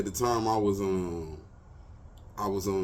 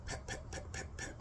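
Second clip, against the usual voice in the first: soft tapping; 16 dB quieter than usual. Retold or imitated. Imitating the tap on the window.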